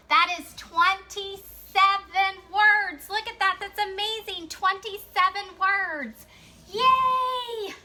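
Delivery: high, loud teacherly cheer, drawn out; excited celebration. Real, not acted. Teacher cheers the class's count of W words, excited celebration.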